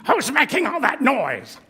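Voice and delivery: high, loud grouchy demand; Oscar voice. Acted. Oscar complaining about the noise, grouchy loud voice. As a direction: grouch popping out of the can. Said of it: "letting me do the voices".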